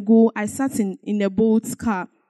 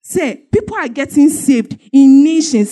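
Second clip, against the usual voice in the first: louder, rising preaching drive; testimony turning into full sermon; 3 semitones higher, 4 dB louder than usual. Acted. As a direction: preach it to the congregation with fire. Preaching builds up.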